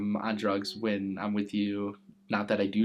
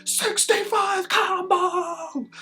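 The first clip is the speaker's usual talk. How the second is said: drawn-out imitated shriek, much higher, louder and slow; ecstatic scream; 6 dB louder, much slower than usual. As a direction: scream it collapsing on the floor in joy. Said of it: "fell to the ground going".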